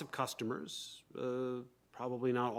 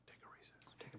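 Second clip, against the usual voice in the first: whispering; 23 dB quieter, faster than usual. Real, not acted. Aside to the bench during recess.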